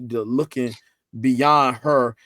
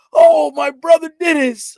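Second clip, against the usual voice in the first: high, loud gushing cry; high, loud mock-gushing church greeting; church ladies' greeting; 12 dB louder than usual. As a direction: mimic church women calling his name with joy. Church women greeting him loudly.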